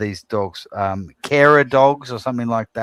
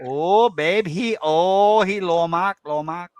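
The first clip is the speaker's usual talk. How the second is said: higher mock Thai accent, gushing; doting Thai accent impression; 6 semitones higher, slower than usual. Wife gushing about her favourite player, high mock Thai accent. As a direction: swoon over him in a light put-on Thai accent. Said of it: "She's like".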